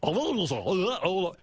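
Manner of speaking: mock announcer hellos turning into monster roars; high announcer babble dissolving into growls; garbled two-man impression. Host shows how Dan and J D sounded together, mock babble into roaring. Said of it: "this is what it sounded like".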